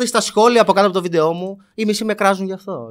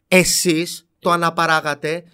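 normal talking voice

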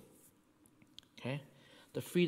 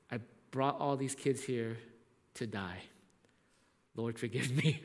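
quiet prayer; 4 semitones lower, 6 dB quieter than usual. Retold or imitated. Leader's silent prayer retold.

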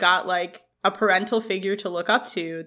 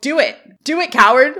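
a goading challenge, louder on the repeat; taunting dare; 5 semitones higher, 8 dB louder than usual. Acted. A fan daring the intimidating man to act, taunting. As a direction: egg them on with rising force.

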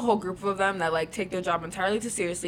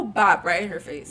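ordinary talk, nothing marked

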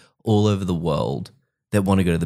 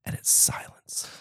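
unvoiced whisper for dramatic effect; hushed; 9 dB quieter than usual. Storyteller revealing the clown's gag met silence, whispered. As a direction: drop to a whisper, letting it die. Tells of audience's dead silence.

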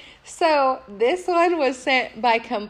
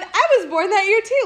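high, loud, eager announcement; mock pestering man; 7 semitones higher, 4 dB louder than usual. Man sending yet another message, mockingly overeager. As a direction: imitate an overeager pest piling on.

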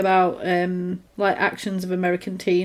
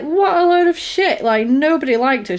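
mock scornful outburst; 7 semitones higher, 4 dB louder than usual. Retells her scornful outburst.

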